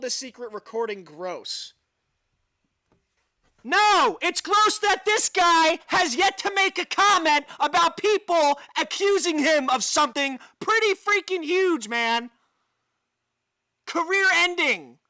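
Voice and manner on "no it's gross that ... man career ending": loud, high, fired-up rant; indignant rant. Real, not acted. Ranting to viewers about the silent company, loud and indignant. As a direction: hammer home the outrage.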